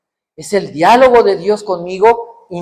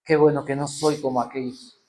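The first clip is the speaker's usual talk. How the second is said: smug Pharisee prayer; 6 semitones lower, 3 dB quieter than usual. Retold or imitated. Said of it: "un hombre fariseo llegó a la sinagoga".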